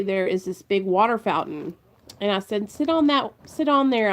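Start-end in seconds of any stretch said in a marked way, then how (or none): 2.7-4.1 calling out. Retold or imitated. Calling son onto fountain for photo.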